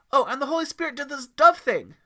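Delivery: put-on high, loud, gushing boy voice; excited kid. Kid excitedly adds to the review, very high and loud. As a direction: blurt it out bouncing with excitement.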